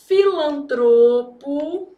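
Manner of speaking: bem devagar, sílaba por sílaba, mais alto; palavra ditada. Shown as a demonstration. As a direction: estique cada sílaba como quem escreve no quadro. Dita a palavra enquanto escreve. (ditando devagar, sílabas alongadas)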